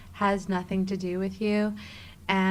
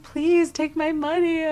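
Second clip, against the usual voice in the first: high, loud weepy plea; whining through pretend sobs; mock crying; 8 semitones higher, 5 dB louder than usual. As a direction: beg tearfully, hamming it up.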